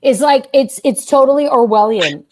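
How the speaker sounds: loud, high incredulous outburst; outraged. Real, not acted. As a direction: blurt it out in disbelief. Outraged at the freedom shirt.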